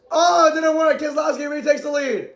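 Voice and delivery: ordinary delivery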